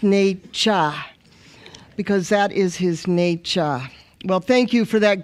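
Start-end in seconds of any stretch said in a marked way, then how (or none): 0.0-3.8 nature said as nay-sha, East African accent. Shown as a demonstration. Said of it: "With the East African accent".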